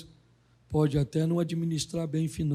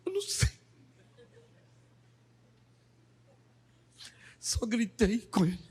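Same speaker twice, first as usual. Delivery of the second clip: mock innocent mother; 4 semitones higher, 4 dB quieter than usual. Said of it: "Ela falou".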